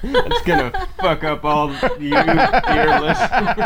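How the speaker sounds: laughing through the words; giggling while speaking. Real, not acted. Laughing at loud audio.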